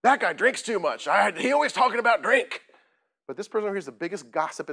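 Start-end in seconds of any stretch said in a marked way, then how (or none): 0.0-2.6 loud, higher mock accuser; judgmental complaint. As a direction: imitate an indignant churchgoer pointing out faults. Acting out judgmental churchgoers.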